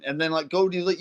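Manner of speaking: ordinary delivery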